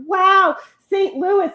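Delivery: high, loud imitation of amazed tourists; exaggerated starstruck wonder; mock awe. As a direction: gush it like an impressed visitor. Imitates awed visitors to city.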